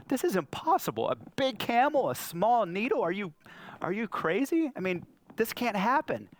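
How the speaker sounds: high-pitched, incredulous protest; hammed-up baffled objection; acted disbelief. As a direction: protest as if it's absurd, pitch jumping up.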